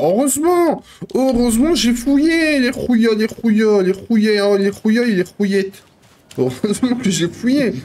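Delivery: high, excited run of gleeful repeats; thrilled, high-pitched gloating; excited relief. Real, not acted. Delighted at finding a satchel.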